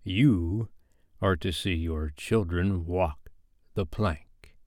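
slow, soft, sugared threat; syrupy Hook. Acted. Hook taunting Wendy about her children, soft syrupy menace. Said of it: "as if he spoke in syrup".

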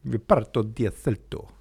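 Reparto di Assalto in a put-on Italian accent; Italian accent on the title. Shown as a demonstration. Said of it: "I can do that accent".

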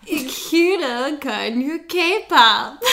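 very slow German with a laugh; slow laughing try. Shown as a demonstration. Learner slowly repeating German, giggling. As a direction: drag out "Ich höre gerne K-pop" through giggles.

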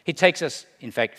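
usual speaking voice

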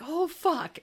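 high, loud mock panic; alarmed. Retelling his reaction to the police threat, alarmed. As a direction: swear in acted-out sudden dread.

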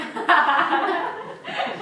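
high-pitched burst of laughter; amused laugh. Real, not acted. Speaker laughs at her own social media post, amused.

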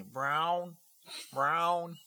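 high meowing brown, brown; high cat voice saying brown. Pretend. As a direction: meow the word brown like a cat. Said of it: "They say".

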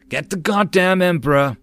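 loud, high battle yell; battle shout. Acted. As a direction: yell it over gunfire. Marine shouts during gunfire.

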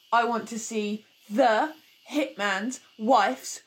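slow, over-precise mock request; exaggerated pedantic ticket order; pernickety customer. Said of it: "I'm the really pernickety person".

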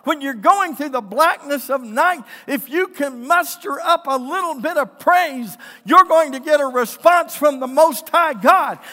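loud, driving sermon building to a shout; full-force preaching. Real, not acted. Preacher urging the congregation to praise in dark times, impassioned.

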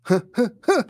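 three sharp huffing breaths; imitated heavy breaths. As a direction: mimic an attacker's charging breaths. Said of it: "all I hear are three distinct breaths".

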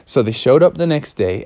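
ordinary delivery, nothing marked

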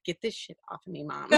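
a put-upon pet grumbling at its owner; grumpy dog voice. Retold or imitated. Dog refusing to be wrapped in lights, fed-up pet voice.

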